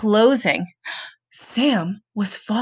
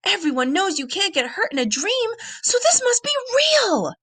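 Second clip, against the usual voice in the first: rising higher in alarm; frightened realisation; 10 semitones higher than usual. A narrator's reading. Boy realising the nightmare is real, alarm rising high. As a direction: let the fear climb to a high pitch.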